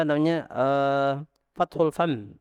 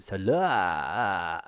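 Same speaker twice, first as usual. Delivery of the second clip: "Salam" much lower, swallowed in the throat; 8 semitones lower, 4 dB quieter than usual. Shown as a demonstration. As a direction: sink the long a of "Salam" down the throat. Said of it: "Suara tenggorokan".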